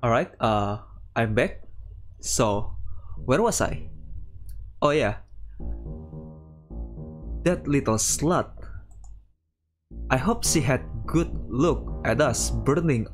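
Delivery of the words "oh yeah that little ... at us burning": normal talking voice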